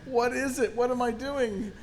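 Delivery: high-pitched, baffled protest; bewildered retold reply. Yelled-at actor to the director, high bewildered reply.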